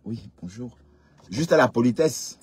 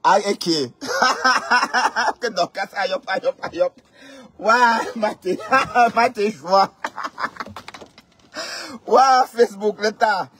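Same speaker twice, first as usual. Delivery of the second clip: mocking laughter; 10 semitones higher than usual. Real, not acted.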